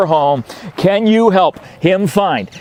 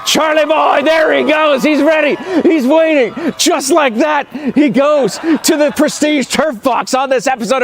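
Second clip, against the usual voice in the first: excited announcer; 6 semitones higher than usual. Acted. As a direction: hype up the crowd like a sportscaster. Hyped dog-catch commentary.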